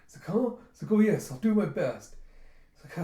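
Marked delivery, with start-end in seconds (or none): 0.2-0.6 high, louder questioning yelp; startled awake. Acted. Sleeper shaken awake at the table, startled. 0.9-2.2 higher, louder hasty promise; flustered eagerness. Acted. Sleeper roused by princess, flustered and eager. Just woken, flustered reply.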